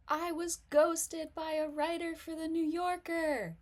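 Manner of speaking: high, loud, stress on New, falling; showy, loud version. Shown as a demonstration. Comic demos the flashy reading, high and loud on New. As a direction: belt it out like a big announcement. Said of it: "On the other, other hand".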